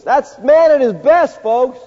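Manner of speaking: loud, high, slow ironic punch; loud ironic exclamation. Acted. Preacher mocks man's goodness to the folks, loud and high. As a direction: throw it at them, ironic and loud. Climax of sin list.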